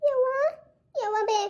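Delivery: high, put-on wail to mother; fake tearful call. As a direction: call out pretending to cry. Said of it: "ಅತ್ಕೋತ ಹೊರ ಕರಿತೇನೆ". (ಅಳುವ ನಾಟಕ ಮಾಡುತ್ತಾ ಅವ್ವನನ್ನು ಕರೆಯುವುದು)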